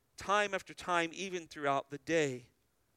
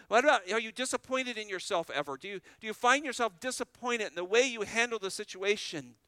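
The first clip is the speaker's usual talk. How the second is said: normal talking voice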